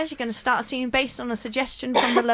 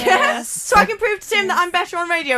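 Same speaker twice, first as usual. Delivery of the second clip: higher, louder manic build; manic host; 8 semitones higher, 5 dB louder than usual. Acted. Host turns on the players, higher and louder, manic. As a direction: start to crack into a villain.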